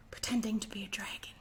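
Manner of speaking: quick little whisper; fast hushed whisper; whispered aside. Acted. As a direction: tell the kids a secret. Aside about the hamster.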